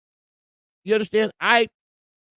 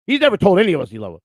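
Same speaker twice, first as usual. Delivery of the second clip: ordinary talk, nothing marked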